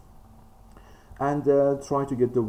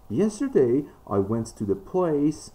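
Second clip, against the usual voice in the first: Yesterday high and loud, place drawn out; strong stress on Yesterday and on place; emphasis on Yesterday and place; 5 semitones higher, 3 dB louder than usual. Shown as a demonstration. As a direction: punch Yesterday, then stretch out place. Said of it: "I put emphasis on this".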